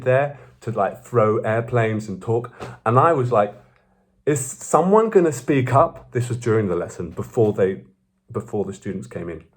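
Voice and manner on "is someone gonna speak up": higher, drawn-out indignant question; exasperated disbelief. Retold or imitated. Annoyed student wondering about noisy classmates, exasperated. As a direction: retell your exasperation, pitch climbing. Retold irritation at disruptive classmates.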